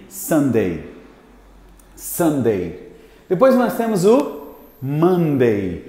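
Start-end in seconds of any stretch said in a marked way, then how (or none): 0.1-2.9 Sunday said lower, clearly, as a model; model pronunciation. Shown as a demonstration. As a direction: say Sunday for them to repeat. (Sunday dito devagar como modelo)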